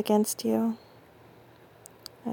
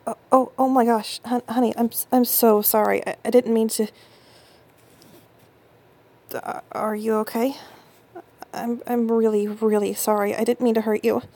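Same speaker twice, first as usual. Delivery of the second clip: louder, stammering panic; frantic stammered sorry; flustered apology; 4 dB louder than usual. Acted. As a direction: tumble out apologies in alarm. Sudden panic after hurting partner.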